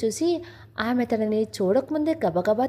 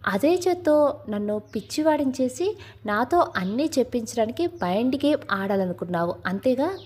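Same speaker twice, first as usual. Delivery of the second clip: ordinary delivery, nothing marked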